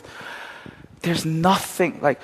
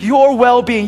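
higher and louder, pressing each word; emphatic preaching; 7 semitones higher, 5 dB louder, slower than usual. Acted. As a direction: declare it with force. Loud take of repeated phrase.

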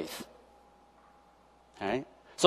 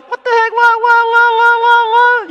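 much higher, louder, sing-song; baffled squeal; 6 dB louder than usual. Retold or imitated. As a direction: repeat what in comic bafflement. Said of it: "Because I'm going".

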